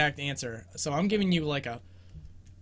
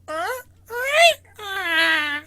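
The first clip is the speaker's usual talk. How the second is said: high strained yawning grunts; 7 dB louder, much slower than usual. Retold or imitated. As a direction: imitate a game character's yawn. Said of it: "like, yawning".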